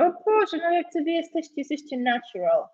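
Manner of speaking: high-pitched, impressed gush; real delighted amazement. Real, not acted. Zachwyt nad odpowiedziami uczniów. (zachwycony, wysoki głos)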